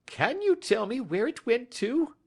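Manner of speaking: reach out to him, voice up high and pleading; high excited plea from a jittery little fellow; nervous little man crying out. A narrator's reading. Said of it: "he cried".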